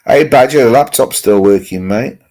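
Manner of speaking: cheerful loud call-out. Real, not acted. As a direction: hail your mate across the room. Greeting a chat viewer by name.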